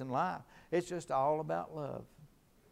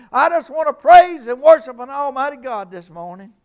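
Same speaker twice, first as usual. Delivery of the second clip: loud, high, driving preacher's cry; full-force preaching; 11 semitones higher, 19 dB louder than usual. Acted. Preacher rousing the congregation, shouting high and loud.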